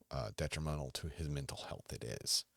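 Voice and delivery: ordinary talk, nothing marked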